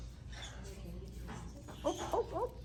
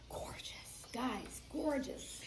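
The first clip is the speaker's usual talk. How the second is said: hushed, breathless whisper; awed whisper; 11 dB quieter, much slower than usual. Real, not acted. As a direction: whisper it in awe.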